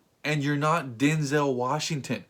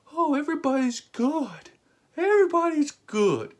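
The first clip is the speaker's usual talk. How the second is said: high, airy, mocking singsong of a naive hero; 12 semitones higher, 3 dB louder than usual. Ranting about superheroes, mocking their naive optimism in a high singsong. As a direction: lift way up and play the clueless optimist.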